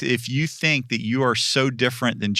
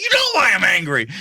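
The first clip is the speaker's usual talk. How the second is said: fast, loud, higher retort; angry Jonah shout; 7 semitones higher, 5 dB louder, faster than usual. Retold or imitated. Jonah shouting back at God, fast and loud. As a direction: snap back in a fed-up shout. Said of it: "He's like".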